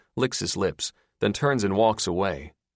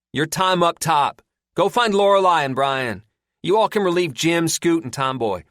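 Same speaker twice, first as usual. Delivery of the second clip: loud, higher, bossy; ordering; 10 semitones higher, 7 dB louder than usual. Acted. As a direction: give out the watch orders. Moss giving orders.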